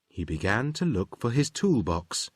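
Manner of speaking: usual speaking voice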